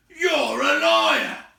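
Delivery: loud, high, slow troll accusation; angry troll. A narrator's reading. William accusing Bert, loud and furious. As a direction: spit the insult out loud. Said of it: "said William".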